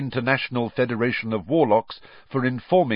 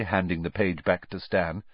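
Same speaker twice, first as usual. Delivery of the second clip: usual speaking voice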